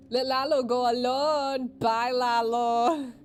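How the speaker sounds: loud, drawn-out taunting send-off; calling out. Acted. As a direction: call it out slowly, stretching each ending. Loudly sending Lalo off.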